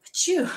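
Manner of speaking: big high sneeze, hammed up; loud mock sneeze; loud fake sneeze. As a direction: throw out a huge theatrical sneeze.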